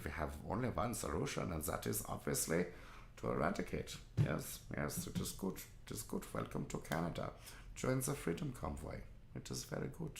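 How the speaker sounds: mock German. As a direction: caricature German villain accent. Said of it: "the filthy Germans".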